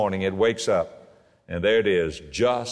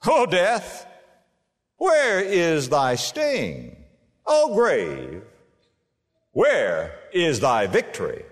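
triumphant taunt; 6 semitones higher, much slower than usual. Acted. Paul's boast quoted.

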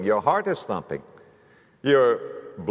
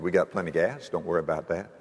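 husband brushing it off; 8 semitones lower, 5 dB quieter, faster than usual. Retold or imitated.

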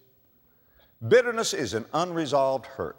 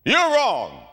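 louder, higher snap of an arguing spouse; mock argument, voice raised; acted loud quarrel; 5 semitones higher, 4 dB louder than usual. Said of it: "That's speaking loudly".

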